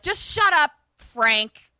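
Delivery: high, loud retold interruption; imitated irritation. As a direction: cut them off in mock annoyance.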